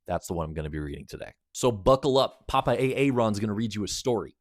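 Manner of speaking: plain reading voice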